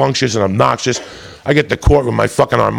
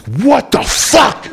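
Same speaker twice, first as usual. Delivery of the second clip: slow, high, drawn-out disbelief; drawn-out stunned what the fuck; 11 semitones higher, much slower than usual. Retold or imitated. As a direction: let it hang in shock. Stunned reaction to sentence.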